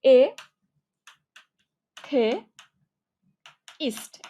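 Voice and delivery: এ, থে, ইস্ট with long pauses between them; syllable by syllable. Shown as a demonstration. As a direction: slowly dictate এ, then থে, then ইস্ট. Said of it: "দাটাকে তুমি থেও উচ্চারণ করতে পারো". (এ, থে, ইস্ট লম্বা বিরতি দিয়ে আলাদা করে)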